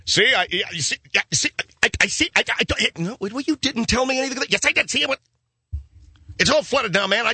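high, whiny squabbling voices; two officials blaming each other, high and petulant; bickering officials. Acted. Mayor and governor squabble in high whiny voices.

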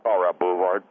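"Boulevard" said as "boo-vard"; slurred boulevard. Acted. Caller repeating the street for the host, "Boulevard" as "boo-vard". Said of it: "What is a boulevard?".

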